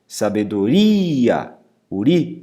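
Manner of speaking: sabedoria with a long stretched RI; stress on penultimate syllable. Shown as a demonstration. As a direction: draw out the RI in sabedoria, then isolate uri.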